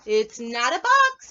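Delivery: loud, slow insistence; emphatic rabbit. Acted. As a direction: slowly insist it is no box. Rabbit answers.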